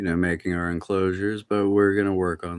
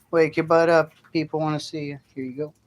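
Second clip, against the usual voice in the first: ordinary talk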